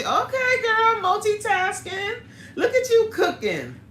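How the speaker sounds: delighted high-pitched praise with a laugh; excited tease. Real, not acted. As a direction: hype her up playfully. Teasing a cooking companion.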